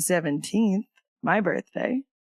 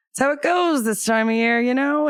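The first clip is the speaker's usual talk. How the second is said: dad's casual voice; 6 semitones higher, 4 dB louder than usual. Retold or imitated. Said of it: "He goes".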